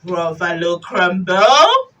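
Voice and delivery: low, loud, slow question; big creature voice swooping up; aghast monster. Acted. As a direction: echo it in dawning horror. Gruffalo shocked at being food.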